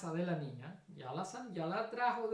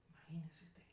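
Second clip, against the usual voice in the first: awed whisper; 2 semitones lower, 10 dB quieter than usual. Real, not acted. Preacher marvels at the miracle.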